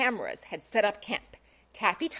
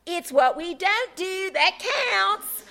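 higher, prim sorority spokesgirl; announced; 6 semitones higher than usual. Acted. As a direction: announce it primly for the group. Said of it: "the self-appointed spokesvirgin announced".

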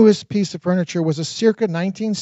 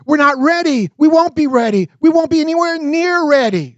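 higher and louder, rising alarm; upset wife protesting; 9 semitones higher, 5 dB louder than usual. A narrator's reading. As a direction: plead in mounting distress.

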